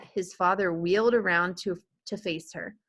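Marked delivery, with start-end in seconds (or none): none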